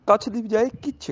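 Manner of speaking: ordinary talk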